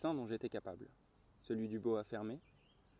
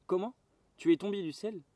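higher-pitched cry of surprise; astonished exclamation; 6 semitones higher, 4 dB louder than usual. A narrator's reading. Little prince learns that the pilot flies, astonished exclamation. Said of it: "il s'écria".

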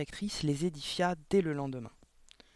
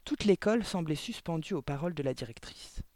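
plain reading voice

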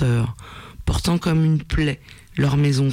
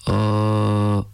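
low, gravelly voice from a deep cave; rocky creature voice; 6 semitones lower than usual. A narrator's reading. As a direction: rumble it like distant thunder, low. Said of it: "articula la pitoyable créature".

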